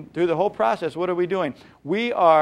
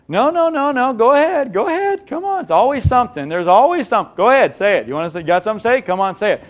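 much higher and louder, mocking challenge; hammed-up defensive goading, pitched up; sarcastic dare; 6 semitones higher, 6 dB louder than usual. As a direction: taunt them to go ahead.

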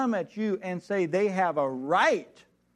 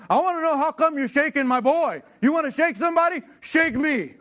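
loud, much higher challenge; angry confrontation; 9 semitones higher, 5 dB louder than usual. Retold or imitated. Dad squaring up to the teacher, loud and furious. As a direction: dare him to try it on you.

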